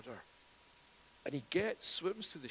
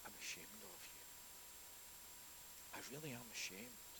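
hushed rebuke; 15 dB quieter than usual. Pretend. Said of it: "within his rights to say".

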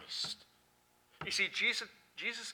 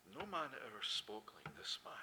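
softer, awed crowd line; awed quote; 6 semitones lower, 10 dB quieter than usual. Retold or imitated. Preacher quoting the awed crowd, softer. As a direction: let the crowd's awe hush you.

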